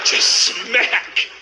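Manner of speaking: high-pitched, stretched-out smack; swaggering smack. Acted. Comedian miming a locker-room swagger, high swaggering smack. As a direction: say it high and drawn out.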